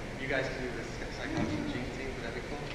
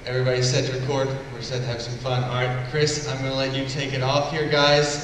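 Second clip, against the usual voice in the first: louder, projecting to the whole room; calling out to room; 6 dB louder than usual. Real, not acted. Host getting everybody ready to record, loud and upbeat.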